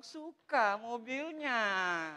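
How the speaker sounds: very slow, drawn-out wifely cooing; put-on wife voice, long dragged vowels; imitating his wife. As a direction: stretch every syllable as the admiring wife. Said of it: "istri saya bilang".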